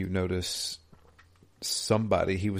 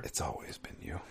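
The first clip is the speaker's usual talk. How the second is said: soft unvoiced whisper, much softer; 5 semitones lower, 13 dB quieter than usual. Real, not acted. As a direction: breathe it as an ominous aside. Teasing joke about the villain.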